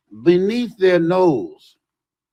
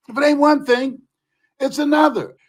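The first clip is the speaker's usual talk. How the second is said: raised, emphatic repeat; 6 semitones higher than usual. Shown as a demonstration. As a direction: say it again with more force.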